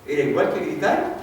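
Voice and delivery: ordinary talk, nothing marked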